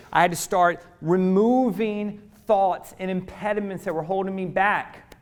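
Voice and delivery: ordinary talk, nothing marked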